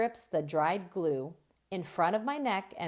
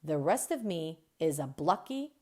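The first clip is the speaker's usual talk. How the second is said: ordinary delivery, nothing marked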